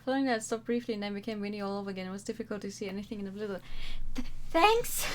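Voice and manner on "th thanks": nervous laugh. Real, not acted. Shaky relieved laugh.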